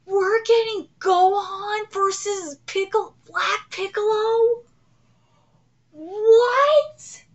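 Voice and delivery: high, rising fan yell; incredulous excitement. Real, not acted. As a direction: shout it in happy disbelief. Cannot believe the matchup.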